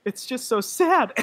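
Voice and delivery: high, slow, tearful break on sad; choked-up sob. Real, not acted. Telling her friend after the credits, tearful voice breaking. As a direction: voice cracking into tears. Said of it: "when I said the word sad, the tears came out again".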